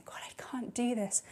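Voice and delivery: whispered, hushed retelling of breaking down; barely voiced, as if alone in a room. Preacher to church, recalling crying alone, in a hushed whisper.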